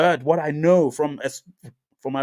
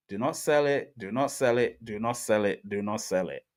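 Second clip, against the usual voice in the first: each "do not sell it" softer and lower, trailing away; fading repetition; 5 semitones lower, 5 dB quieter than usual. Real, not acted. Pleading with holders, fading to near silence. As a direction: let the plea fade out.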